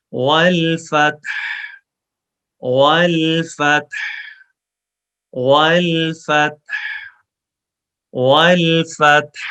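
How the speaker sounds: والفتح ending on a clear breathy ha, slow. Shown as a demonstration. Teacher drilling the final ha for students, slow and clear. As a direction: let the ha of والفتح ring out at the end. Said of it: "تو ہا کی آواز نکالیں".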